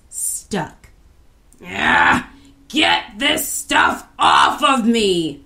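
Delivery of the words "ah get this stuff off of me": loud, slow, growled bellow; furious troll yell. A narrator's reading. Troll stuck in slime, furious yell. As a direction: roar it while stuck fast. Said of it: "he yelled".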